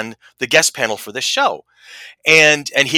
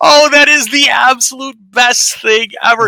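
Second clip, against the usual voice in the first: high, loud burst of delight; retold overjoyed exclamation; elated gushing; 12 semitones higher, 8 dB louder than usual. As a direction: gush at full volume, thrilled for him. Retells own elation at the moment.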